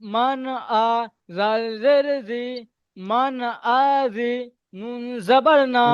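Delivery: slow, with each syllable cut off separately; staccato. Shown as a demonstration. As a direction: spell it out syllable by syllable with pauses. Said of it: "একটা একটা কেটে কেটে দেখেন".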